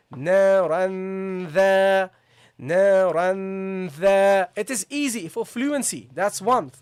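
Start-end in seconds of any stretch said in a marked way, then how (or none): 0.1-4.5 naran dha very slow, n held long and louder; long ghunnah. Shown as a demonstration. Teacher demonstrating prolonged ghunnah to students. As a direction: stretch naran before dha. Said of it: "so you say".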